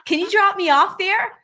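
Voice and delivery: put-on quick, high Boston accent rider; Boston accent, rushed and bright. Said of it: "I was giving it a Boston accent".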